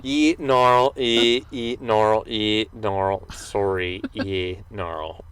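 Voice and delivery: Australian "yeah, no" dragged into "ye gnarl"; mock Australian accent. Host imitating Australians answering a question, mock Australian accent. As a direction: dither between yes and no in exaggerated Australian. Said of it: "That's how Australians say no".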